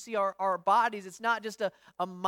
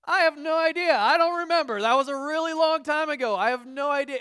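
higher, louder, breezy dismissal; a mock carefree answer from someone in eternity; cheerful shrug; 5 semitones higher, 7 dB louder than usual. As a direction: toss it off like it barely matters. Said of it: "What do you think your response is gonna be".

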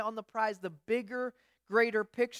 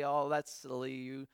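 put-on talking down to a little kid, lower and softer; condescending to a child; 9 semitones lower, 7 dB quieter than usual. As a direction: lower and much softer, patronising. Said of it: "you kinda say".

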